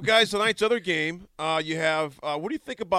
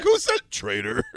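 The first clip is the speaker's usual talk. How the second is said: laughing; 9 semitones higher than usual. Retold or imitated. Retelling his shocked reaction, laughing.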